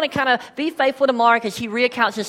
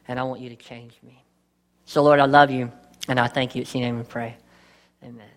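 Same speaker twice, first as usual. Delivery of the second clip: prayer; 7 semitones lower than usual. Acted. Opening prayer before sermon.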